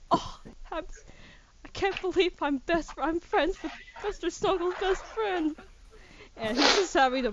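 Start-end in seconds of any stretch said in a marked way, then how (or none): 0.1-5.6 higher, half-breathless gush of emotion; overwhelmed Darius. Acted. Darius clutches his chest, awed to meet the dog's best friend, higher moved voice. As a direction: hand on heart, overcome. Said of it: "He's like".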